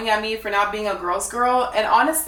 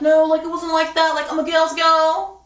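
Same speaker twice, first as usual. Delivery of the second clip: higher whiny mock of her denial; mock defensive; 7 semitones higher, 3 dB louder than usual. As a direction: parody her protesting innocence. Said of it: "she goes on and said".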